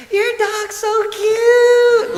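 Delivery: very high, drawn-out gushing yell; yelling out the window. Retold or imitated. Neighborhood mom calling from her car to a dog walker, yelling. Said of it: "she's yelling out the window".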